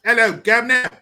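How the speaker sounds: loud, high caricature British greeting; mock British accent. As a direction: call out like a hammed-up Brit. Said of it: "You guys got a bunch of accents".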